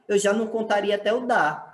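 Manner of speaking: ordinary delivery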